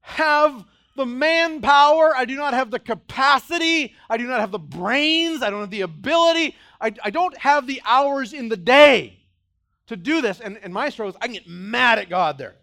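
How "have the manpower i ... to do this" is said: overwhelmed protest. Retold or imitated. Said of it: "You're like".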